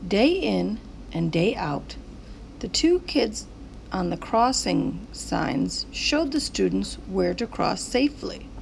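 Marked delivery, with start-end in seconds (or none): none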